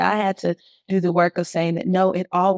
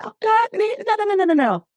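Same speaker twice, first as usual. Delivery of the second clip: much higher, pleading string of no's; anguished protest; 14 semitones higher than usual. Retold or imitated. Retelling to the host her reaction when he wouldn't reconcile, high anguished no's. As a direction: cry out the no's in pitched-up dismay. Recalling husband refusing reconciliation.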